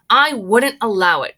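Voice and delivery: loud firm cry. Acted. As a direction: refuse loudly. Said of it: "cried Mister Wonka".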